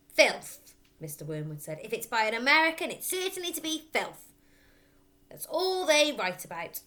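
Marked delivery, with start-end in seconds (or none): 0.1-0.7 disgusted outburst. A narrator's reading. Father scoffs at the book. 1.8-6.8 scornful rant. A narrator's reading. Father rants about Americans.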